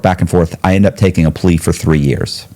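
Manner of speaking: usual speaking voice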